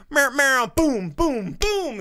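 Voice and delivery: high, loud cartoonish meow and boom sounds; mock action noises. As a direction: imitate the on-screen hits with playful noises. Imitating movie action noises.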